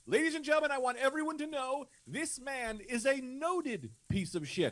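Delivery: high, ringing public announcer; mock announcement. Man addressing the turning crowd, high mock announcement. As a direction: proclaim it to a crowd on a high held pitch. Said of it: "I started making announcements".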